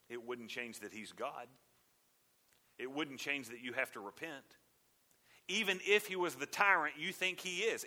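ordinary talk

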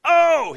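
high loud cry; pained cry. Retold or imitated. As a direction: burst out in anguish. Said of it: "he said".

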